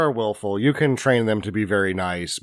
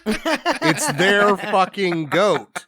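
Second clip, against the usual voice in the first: It's their fucking goat, each word slow and higher; emphatic, slow and high; 9 semitones higher, much slower than usual. Real, not acted. Repeats point with heavy emphasis.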